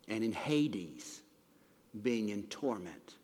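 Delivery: slow, soft and low; hushed and grave. Acted. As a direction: let the word torment sink. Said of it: "slow grave reading of torment".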